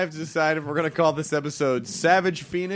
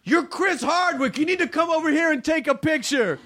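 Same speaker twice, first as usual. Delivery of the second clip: pushy fan; 10 semitones higher than usual. Retold or imitated. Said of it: "he started to get real aggressive".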